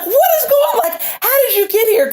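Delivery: high-pitched, upset retold questioning; imitated upset. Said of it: "he was so upset".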